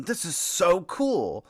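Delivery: high, drawn-out mock gushing; mock-thrilled fanboy call; imitated excited call-out. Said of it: "we hear Ford call out into the".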